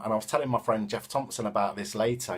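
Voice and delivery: usual speaking voice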